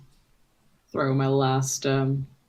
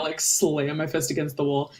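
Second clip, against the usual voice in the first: ordinary talk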